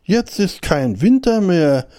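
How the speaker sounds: high, drawn-out storybook rejoicing; exult, high and stretched; jubilant. A narrator's reading. Hare discovering winter is over, high jubilation. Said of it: "jauchzte der Hase".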